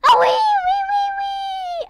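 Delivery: high, loud repeated wees; gleeful squeals. Real, not acted. Kid sliding down, squealing with glee. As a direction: squeal all the way down.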